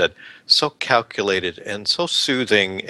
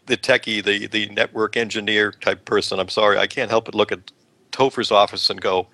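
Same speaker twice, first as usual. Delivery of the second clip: usual speaking voice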